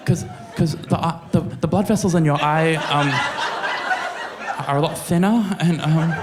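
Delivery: high, giggly, halting; trying to explain through laughter; laughing explanation. Retold or imitated. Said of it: "his own retold reply".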